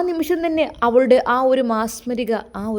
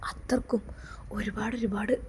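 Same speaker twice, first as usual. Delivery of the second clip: softer, faltering voice; voice breaking; 9 dB quieter than usual. A narrator's reading. Jaseer telling Noorjahan how deeply he loves her, softly. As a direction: let the words sink away. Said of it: "ജസീറിൻ്റെ ശബ്ദം ഇടറുന്നുണ്ടായിരുന്നു".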